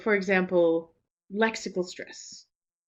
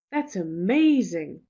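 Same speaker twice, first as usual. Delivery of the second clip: "amazing" hit hard and louder, slowly, falling; stress on amazing; 5 dB louder, slower than usual. Shown as a demonstration. Teacher demonstrates strong stress on "amazing" to learners. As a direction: lean into "amazing" with heavy stress. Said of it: "the stress that I really put on amazing".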